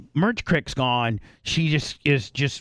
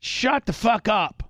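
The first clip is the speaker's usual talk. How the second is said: retold yell; 7 semitones higher, slower than usual. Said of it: "I just yell at her".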